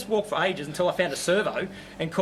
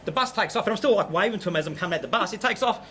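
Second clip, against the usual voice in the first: ordinary delivery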